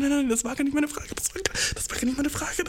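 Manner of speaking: high, whispered frantic denial; panicked whisper. Retold or imitated. He whispers to her that was not his question, high and panicked. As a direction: whisper it in a flustered rush. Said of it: "ich war dann so".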